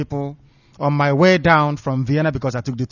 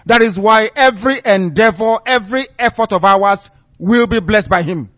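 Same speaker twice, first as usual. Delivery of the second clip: raised, louder preaching voice, building conviction; fiery preaching; 4 semitones higher, 7 dB louder than usual. Acted. Preacher to radio listeners, loud and fervent. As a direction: preach it out to the faithful.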